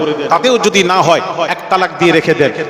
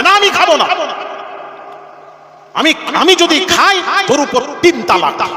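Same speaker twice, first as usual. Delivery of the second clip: high, stubborn refusal; angry husband refusing; 9 semitones higher than usual. Acted. Husband refusing the egg, high and stubborn. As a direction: swear it out in a huff.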